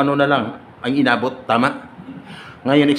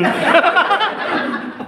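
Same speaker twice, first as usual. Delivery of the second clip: very high cackle; high laughter. Real, not acted. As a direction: laugh at the sleepy guest. Laugh at sleepy one. (mataas na tawa)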